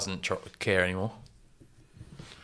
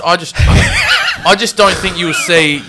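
breaking into laughter mid-sentence; louder, higher, words broken up by laughter; laughing; 6 semitones higher, 8 dB louder than usual. Real, not acted. Cracks up while starting his argument.

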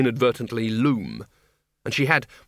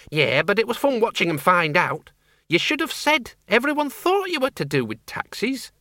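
tart countrywoman with a grudge; feisty old farm woman; 8 semitones higher than usual. Acted. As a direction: rattle it off, indignant. Flitworth character.

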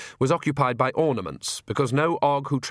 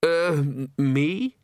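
timid, higher-pitched stammer; nervous young priest's quaver; 4 semitones higher, much slower than usual. A narrator's reading. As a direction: falter as if suddenly singled out. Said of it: "he quavered".

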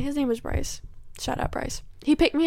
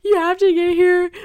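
higher, louder, faked frantic plea; mock hysterical friend; 6 semitones higher, 5 dB louder than usual. Friend on the phone faking a crisis, mock hysterical plea. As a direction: play the sobbing friend, half in fun. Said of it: "bawling her eyes out, hysterical".

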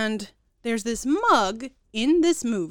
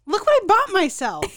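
high, louder teasing sister voice; mocking sister; 9 semitones higher, 5 dB louder than usual. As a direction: play the sister showing off her buy. Sister's text read mockingly.

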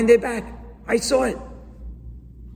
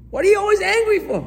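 much higher, fast exasperated challenge; mock exasperation; 12 semitones higher, faster than usual. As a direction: fire it back as an exasperated friend. Exasperated accuser voiced in sermon.

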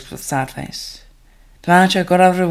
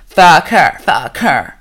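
loud jeering chant; shouting; 6 dB louder, much slower than usual. A narrator's reading. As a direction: bark it like a jeering crowd.

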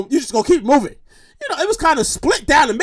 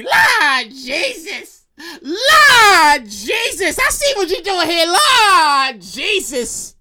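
long drawn-out cries to the Lord; praising family member; much slower than usual. Acted. Family thanking God for found cash, wailing praise. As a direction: stretch each word like a church testimony.

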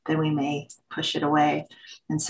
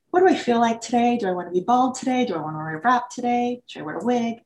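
quick, higher-pitched string of self-questions; musing to herself; 6 semitones higher, faster than usual. Retold or imitated. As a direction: imitate running through options, pitched up and brisk. Voices her morning self-questions.

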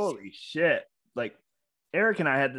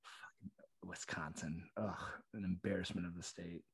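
very low, hushed disgust; quiet, exasperated aside; disgusted mutter; 6 semitones lower, 13 dB quieter than usual. Real, not acted. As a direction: grumble it under your breath. Disgusted aside about Wisconsin.

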